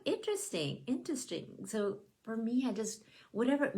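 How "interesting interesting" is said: skeptical. Retold or imitated. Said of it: "I'm like".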